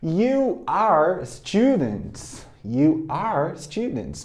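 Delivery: You are students, slow with you and are apart; teacher modelling the long form; full form, slow. Shown as a demonstration. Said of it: "Mas a forma contraída".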